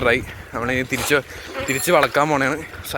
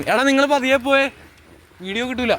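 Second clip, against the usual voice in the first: calling out; 11 semitones higher, 3 dB louder than usual. Real, not acted. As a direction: shout after friends ahead. Calling to friends to slow.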